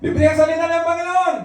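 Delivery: high, sustained open-air call; public shout. Shown as a demonstration. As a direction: shout it out to passers-by. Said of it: "sumigaw sa public na ganon".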